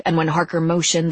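ordinary delivery

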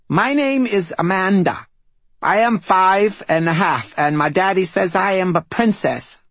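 put-on high, babyish five-year-old; little girl voice. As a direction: lisp it out like a proud little princess. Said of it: "words edged with babyish syllables".

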